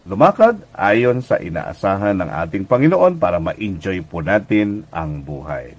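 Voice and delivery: normal talking voice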